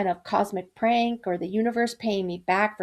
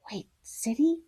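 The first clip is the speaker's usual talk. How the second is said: shocked whisper; 5 semitones higher, 5 dB quieter than usual. A narrator's reading. Narrator's shocked inner thought.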